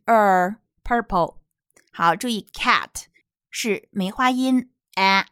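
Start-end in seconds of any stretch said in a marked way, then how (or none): 0.1-1.4 "purple" with an American r-coloured er. Shown as a demonstration. Teacher shows American pronunciation, long er in "purple". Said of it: "美式发音是er".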